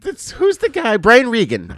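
cracking on the name. Real, not acted. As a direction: voice cracks up high. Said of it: "my voice just cracked".

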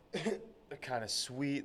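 holding back a hiccup, slow; words squeezed past a hiccup; suppressing hiccups. Real, not acted. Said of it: "Sorry, I have the hiccups right now".

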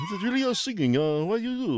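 mock a pop singer in a clumsy Middle Eastern accent; bad Middle Eastern accent. Podcast host mocking the Milli Vanilli singers, in a bad Middle Eastern accent. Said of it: "my horrible, uh, like, Middle Eastern accent".

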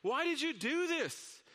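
high, slow exasperated yell; the man yells over the edge in frustration; frustrated yell. Acted. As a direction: demand an answer, fed up. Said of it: "he yelled in frustration over the edge".

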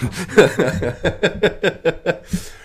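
a quick amused laugh; short laugh. Real, not acted. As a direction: laugh at the joke. Laughs at coin-flip joke.

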